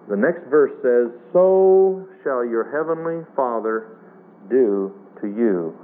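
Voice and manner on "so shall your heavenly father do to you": scripture reading. Acted. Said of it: "reads the verse".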